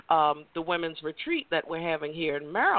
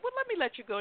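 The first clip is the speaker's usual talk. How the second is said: polite sign-off; 5 dB quieter, much faster than usual. Retold or imitated. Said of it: "And somehow I said".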